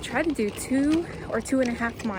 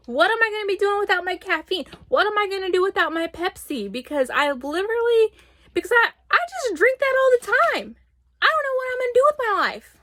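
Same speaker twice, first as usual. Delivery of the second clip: high, frantic mock despair; mock panic; 8 semitones higher, 3 dB louder than usual. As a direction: wail dramatically about no caffeine. Panic over losing Pepsi.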